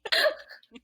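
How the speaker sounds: high-pitched burst of giggling; high giggle. Real, not acted.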